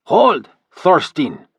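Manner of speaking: loud, slow barked order; commanding shout. A narrator's reading. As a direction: stop the fight with one word. Leader halts the attack.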